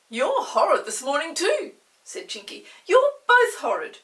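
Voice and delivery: high, loud pixie scolding; pixie retort. Acted. Chinky scolds both children, high and cross.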